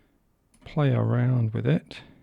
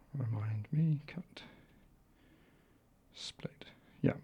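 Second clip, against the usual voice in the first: whispering; 2 semitones higher, 10 dB quieter than usual. Real, not acted. Muttering to himself while editing.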